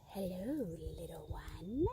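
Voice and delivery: low, hushed cat voice; soft purr. Acted. Cat greeting the little bird, soft low purr. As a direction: purr it softly, nearly a whisper. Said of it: "The cat purred softly and said".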